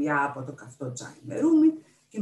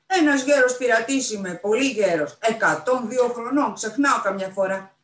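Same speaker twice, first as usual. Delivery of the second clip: put-on loud, higher-pitched old pirate; sly old pirate; 6 semitones higher, 9 dB louder than usual. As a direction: plead old age playfully.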